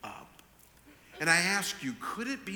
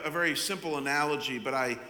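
normal talking voice

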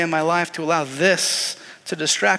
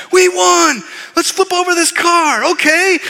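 much higher, shouting sports fans; rowdy fans; 10 semitones higher, 6 dB louder, slower than usual. Retold or imitated. Fans after a Super Bowl win, rowdy shouting. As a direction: whoop it up like rioting fans.

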